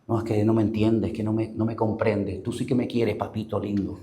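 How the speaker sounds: low, hushed imitation of a lover; secret sweet talk. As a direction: murmur it intimately, fading to very soft. Acts secret late-night chat with lover. (imitando coqueteo secreto, en voz baja)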